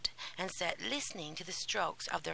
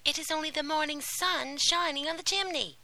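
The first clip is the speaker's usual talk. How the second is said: wicked stepmother; 9 semitones higher, 4 dB louder than usual. A narrator's reading. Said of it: "said the stepmother".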